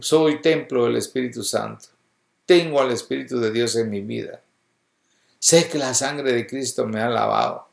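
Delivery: ordinary delivery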